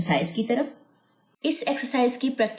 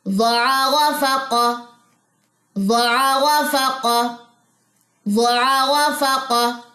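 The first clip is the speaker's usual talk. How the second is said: slow, sing-song syllables; rhythmic reading; 6 semitones higher, 4 dB louder, much slower than usual. Shown as a demonstration. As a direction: read it in one steady rhythm. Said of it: "पूरी line को mark करें".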